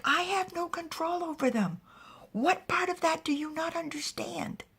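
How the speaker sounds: high-pitched acted exasperation; exasperated. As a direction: insist in frustration, voice up high. Exasperated man answers in story.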